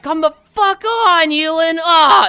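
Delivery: high, loud, exasperated shout of my name; frustrated team hollering in the gym; teammates yelling. Retold or imitated. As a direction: yell at the kid who lost the ball. Team yells at failed player.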